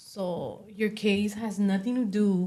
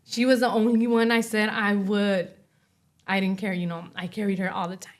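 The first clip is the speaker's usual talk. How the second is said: ordinary talk, nothing marked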